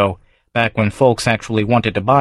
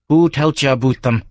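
hoarse demand; 4 semitones higher than usual. A narrator's reading. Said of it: "he demanded hoarsely".

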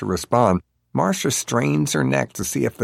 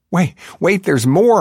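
high, loud desperate call; pleading, pitched-up outcry; confused cry; 10 semitones higher, 6 dB louder than usual. A narrator's reading. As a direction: cry out after someone in vain. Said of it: "vainly cries out in confusion".

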